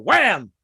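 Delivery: loud, sudden wham; punched, swooping sound effect; door slam noise. Retold or imitated. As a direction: shout the sound of a slammed car door. Imitates slamming car door.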